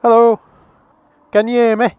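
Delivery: normal talking voice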